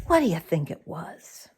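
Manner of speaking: ordinary delivery, nothing marked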